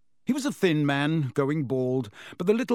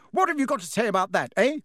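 higher, fast hammering demand; fast angry demand; 8 semitones higher, 4 dB louder, faster than usual. A narrator's reading. Wife demands her husband answer, shouting. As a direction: fire the question at him. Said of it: "shouted Missus Weasley".

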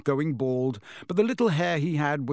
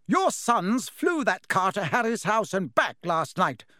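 shouting; 6 semitones higher than usual. A narrator's reading. Wife shouts at her husband about their sons. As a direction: shout the news at him. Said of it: "shouted Missus Weasley".

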